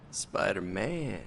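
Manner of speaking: slow, low and quiet; low hero voice. Shown as a demonstration. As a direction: draw the name out darkly. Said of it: "Practice their hero voice".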